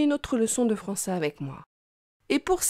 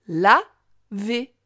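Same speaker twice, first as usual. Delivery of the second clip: "Laver" as la... vé, pause between; split into syllables; 4 dB louder than usual. Shown as a demonstration. Teacher helping the child hear la, syllable by syllable. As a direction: break Laver into two separate syllables. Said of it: "Tu entends bien la syllabe la".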